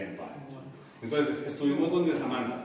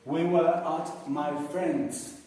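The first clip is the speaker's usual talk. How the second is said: slow model sentence; much slower than usual. Shown as a demonstration. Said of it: "la misma historia".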